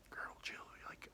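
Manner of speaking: hushed, whispered warning; acted urgent whisper; secretive whisper. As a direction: whisper so the driver can't hear. Hushed reply, sister within earshot.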